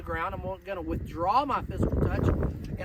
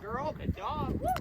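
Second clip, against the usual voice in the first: higher, bright praise for the dog; cheerleader praise; 8 semitones higher than usual. Real, not acted. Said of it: "channel my inner cheerleader".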